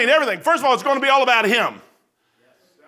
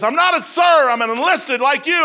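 gruff correction; 6 semitones higher, 5 dB louder than usual. Retold or imitated. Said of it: "He goes".

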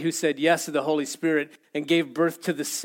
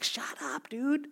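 higher, hushed stage whisper; hushing whisper; 9 semitones higher, 9 dB quieter, slower than usual. Acted. Crowd around the blind man telling him to shut up, whispering. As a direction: whisper a scandalized shush.

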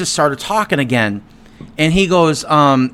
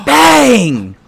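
sudden high, loud "bang"; imitated bang; 8 semitones higher, 4 dB louder than usual. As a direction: bang out a startling firework blast. Imitating a sudden firework blast.

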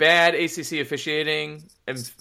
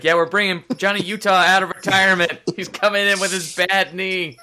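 higher, louder hyped announcement of a comeback; mock coach announcement; 7 semitones higher, 5 dB louder than usual. Fan joking as coach in a higher, louder hyped announcement. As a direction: sell it like big news. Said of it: "being like".

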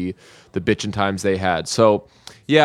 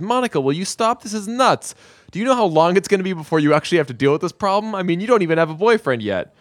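higher, fast, fed-up rant; exasperated; 7 semitones higher, faster than usual. Acted. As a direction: roll your eyes and rattle it off fast. Chandler exasperated with Monica.

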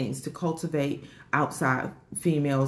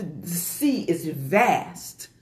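very slow savouring; much slower than usual. Real, not acted. Savouring a repeated phrase.

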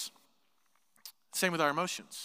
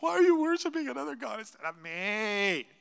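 mock jealous whine; 6 semitones higher than usual. Preacher mimicking a jealous god's complaint, mock whine. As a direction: whine it like a wounded, jealous god. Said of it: "He's not angry at them 'cause he's like".

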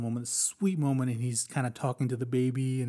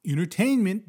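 higher, louder mock enthusiasm; mock delight; 8 semitones higher, 6 dB louder than usual. Viewer pretending war is just entertainment, mock cheer. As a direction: toss it off like a casual viewer. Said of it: "you're kinda like".

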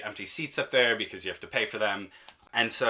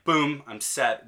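smug relief; 4 semitones higher, 3 dB louder, much slower than usual. Retold or imitated. Triumphant relief at iPad.

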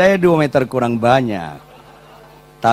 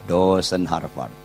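hushed prayer; 8 semitones lower, 8 dB quieter than usual. Retold or imitated. Quiet inner prayer.

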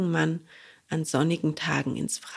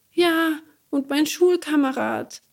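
high, louder outraged cry; indignant puppet boy; 10 semitones higher, 4 dB louder than usual. Acted. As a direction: protest like a hurt child. Bengele insists.

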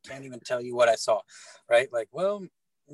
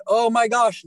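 much higher and louder burst of dismay; sudden realization; 7 semitones higher, 8 dB louder than usual. Retold or imitated. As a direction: gasp it out as the truth hits. Realizing the pastor's father died.

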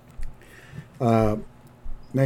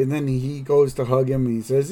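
usual speaking voice

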